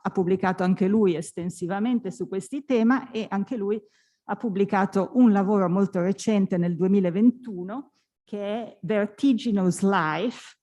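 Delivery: ordinary delivery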